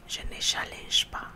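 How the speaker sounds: usual speaking voice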